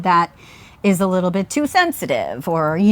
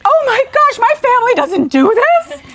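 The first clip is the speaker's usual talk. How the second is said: put-on high-pitched, loud kid in alarm; retold childhood panic; 12 semitones higher, 4 dB louder than usual. Child alarmed that family has no fire plan, mock panic. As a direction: blurt it out like an overwhelmed, worried child.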